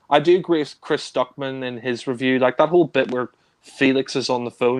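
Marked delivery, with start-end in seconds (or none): none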